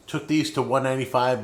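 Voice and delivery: ordinary delivery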